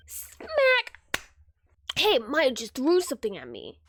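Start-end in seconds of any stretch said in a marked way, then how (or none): 0.1-1.2 high, squeaked smack sound effect; imitated smack. Thrown object hits the lawyer, high voiced smack. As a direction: voice the smack far above your usual pitch.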